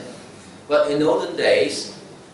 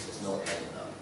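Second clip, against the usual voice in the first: "There's no end in love" repeated much softer, almost breathed; near-whisper echo; 5 semitones lower, 14 dB quieter than usual. Shown as a demonstration. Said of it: "repeated for emphasis".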